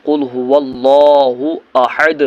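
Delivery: slow, drawn-out melodic recitation; measured tajweed recitation; slow Quran recitation. Shown as a demonstration. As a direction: chant each word slowly and reverently. Recites verse slowly.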